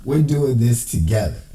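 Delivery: usual speaking voice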